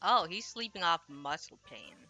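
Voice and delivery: ordinary talk, nothing marked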